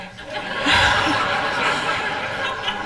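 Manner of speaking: high, breathy burst of laughter; real laugh. Real, not acted. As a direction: crack up at your own memory. Laughs recalling exchange with doctor.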